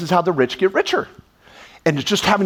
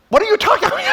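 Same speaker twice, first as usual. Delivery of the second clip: much higher exasperated disbelief; as if the idea is absurd; incredulous; much faster than usual. Acted. Retorting to worried accountant.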